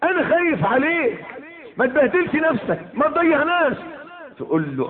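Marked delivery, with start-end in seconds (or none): 0.0-3.8 high pleading father voice. Retold or imitated. Preacher voicing a worried father pleading with his son, high. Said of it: "يقول لك".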